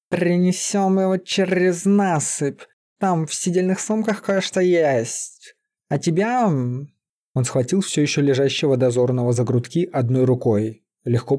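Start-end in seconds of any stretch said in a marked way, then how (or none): 0.1-6.9 slow, high growly drawl; drawn-out, rolled-r creature voice; beast-man. A narrator's reading. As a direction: take charge in a growling beast voice. Beast-man takes charge.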